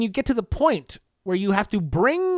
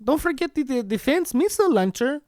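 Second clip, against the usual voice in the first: lightly voiced Melania, pitched higher, sweetly reminding; a fond wife's gentle nag, a bit higher; wife reminding husband to pack the missile launcher, lightly higher and sweet; Melania impression; 6 semitones higher than usual. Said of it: "I have to try and do my Melania impression".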